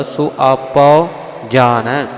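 ਆਪਾ with a long final aa, slow and loud; ਆਪਾ drawn out, then ਜਾਣੈ with a rising end; careful recitation. Shown as a demonstration. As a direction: model ਆਪਾ ਜਾਣੈ syllable by syllable. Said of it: "ਇਸ ਪ੍ਰਕਾਰ ਆਵਾਜ਼ ਆਉਣੀ ਹੈ".

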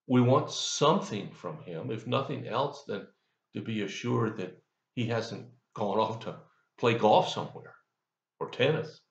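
normal talking voice

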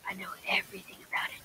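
fast smug whisper. Retold or imitated. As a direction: hiss it under your breath. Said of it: "you're like".

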